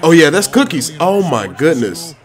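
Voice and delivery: ordinary delivery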